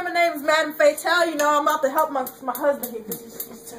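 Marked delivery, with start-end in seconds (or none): none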